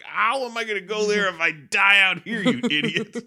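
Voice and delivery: hammed-up annoyance at a useless mentor; exasperated retort. Exasperated Luke answering Ben.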